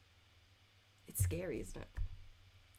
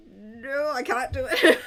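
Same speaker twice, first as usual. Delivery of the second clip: louder and higher, laughing it off; laughing refusal; 7 semitones higher, 15 dB louder than usual. Real, not acted. Streamer refusing viewer's Scottish accent request, laughing loudly. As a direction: giggle through the no.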